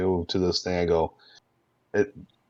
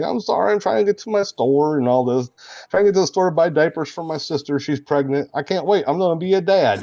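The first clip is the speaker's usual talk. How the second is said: Zanzibar Clayton voice; 10 semitones higher, 4 dB louder, faster than usual. Acted. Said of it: "a guy named Zanzibar Clayton".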